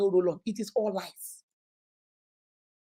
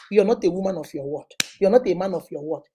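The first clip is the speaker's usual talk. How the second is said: ordinary delivery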